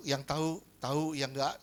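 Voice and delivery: usual speaking voice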